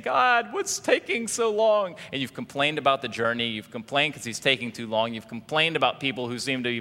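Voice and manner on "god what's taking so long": high, drawn-out mock whine; whining complaint. Preacher mimics people complaining to God, whining. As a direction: whine it loudly, dragging out the words. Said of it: "if you've been whining, complaining, saying".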